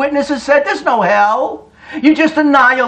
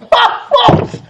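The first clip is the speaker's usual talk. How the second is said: burst of laughter; 13 semitones higher than usual. Real, not acted. Preacher cracks up at the passage.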